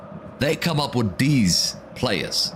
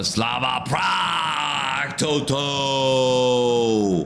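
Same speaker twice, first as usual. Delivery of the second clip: long, drawn-out shout as the ball goes in; excited goal shout; 4 semitones higher, much slower than usual. Real, not acted. Streamer reacting to a Slava Prague corner, excited goal shout. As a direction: shout it out, stretching the last word.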